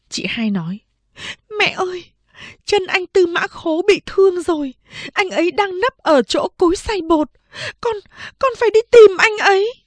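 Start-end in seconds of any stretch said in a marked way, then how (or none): 1.6-9.7 high, rushing at the end; urgent plea. Acted. Second sister insisting she must find the wounded man, urgent. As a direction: insist desperately.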